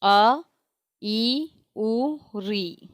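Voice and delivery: अ, ई, उ, री each said short and clear, one by one; short vowels called out. Shown as a demonstration. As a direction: sound out अ, ई, उ, री as a teacher demo. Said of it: "It's four". (ह्रस्व स्वरों का स्पष्ट उच्चारण)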